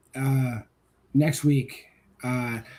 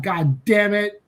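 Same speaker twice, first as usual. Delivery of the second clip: usual speaking voice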